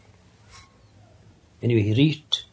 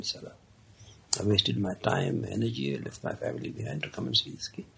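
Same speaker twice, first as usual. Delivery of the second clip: soft, half-voiced regret; mild muttered dismay; muttered thoughts; 2 semitones lower, 7 dB quieter than usual. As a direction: grumble it quietly to yourself. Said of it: "voiced his thoughts aloud".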